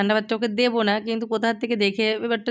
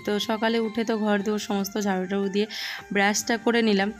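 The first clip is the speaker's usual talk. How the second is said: ordinary delivery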